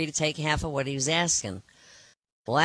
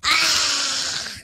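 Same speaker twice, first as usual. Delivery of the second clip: high, loud cry of a cat about to be hit; mock scream; 11 semitones higher, 5 dB louder than usual. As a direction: let out a sudden high yelp of fright. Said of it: "covered his eyes with both hands and went".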